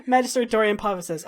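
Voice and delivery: ordinary talk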